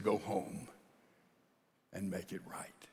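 whispered, fading to breath; hushed quote. Retold or imitated. Wife recalling her mother's advice to a friend, in a whisper. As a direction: breathe the words almost silently.

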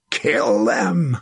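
strained battle command; knight's rallying bellow. A narrator's reading. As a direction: bellow it to your men in the fight. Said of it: "Sir John bellowed".

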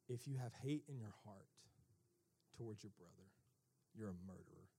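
hushed, low-pitched pronouncement; quiet gravity. Acted. Jesus confronting Pharisees about hate, quietly grave. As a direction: drop very soft and low to convict them.

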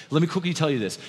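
ordinary talk, nothing marked

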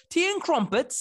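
very high, loud cartoon British accent. Host jokes about London, hammed-up British accent. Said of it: "a little British accent".

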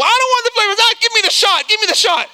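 very high-pitched imitation of a frantic son; panicked child. Son jumping on the table before the nurse, mock panic. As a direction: act out a kid's hammed-up panic, voice shooting up.

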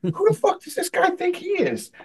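high, drawn-out mock outrage; retold indignant outburst. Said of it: "I said it like that too".

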